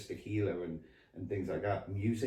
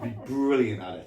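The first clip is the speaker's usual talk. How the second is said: ordinary talk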